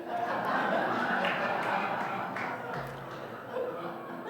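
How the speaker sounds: long breathy unvoiced laugh; real laugh. Real, not acted. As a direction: laugh at the goat punchline. Laughs after the punchline.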